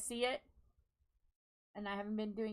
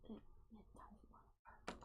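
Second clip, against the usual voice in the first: whispering; 16 dB quieter, slower than usual. Real, not acted. Whispered frustration.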